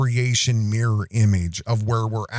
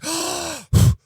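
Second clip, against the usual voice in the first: high, sharp delighted gasp; toddler gasp; 3 dB louder than usual. Retold or imitated. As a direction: gasp like a toddler spotting bubbles. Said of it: "She's like".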